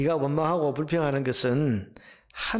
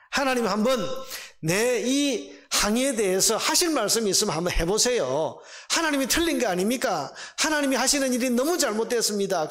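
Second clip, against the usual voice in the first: defiant protest, pitched higher; Habakkuk; 4 semitones higher than usual. Acted. Prophet taking God to task, defiant protest. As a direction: challenge God like an aggrieved complainant. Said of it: "따지고 항의하고 대들고 있는 이런 하박국의".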